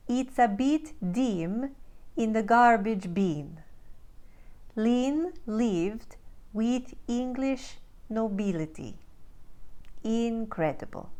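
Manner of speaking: slow Ukrainian-accented practice sentences. Shown as a demonstration. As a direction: speak slowly in Ukrainian accent, every short I as EE. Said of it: "Let's do a little bit more for practice".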